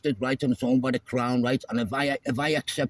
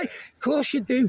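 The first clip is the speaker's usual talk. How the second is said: mocking laugh; 10 semitones higher, slower than usual. Real, not acted.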